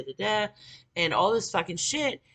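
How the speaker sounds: ordinary talk, nothing marked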